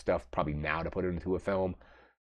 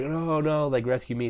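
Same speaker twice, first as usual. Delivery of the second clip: higher, pleading cry to be rescued; desperate plea; 8 semitones higher than usual. Retold or imitated. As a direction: beg them not to leave you. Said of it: "He's like".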